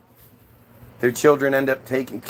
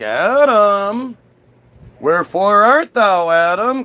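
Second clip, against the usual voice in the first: higher, louder mock-divine call; 5 semitones higher, 5 dB louder, slower than usual. God gets home looking for Adam, calling out. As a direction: call across a garden in theatrical style.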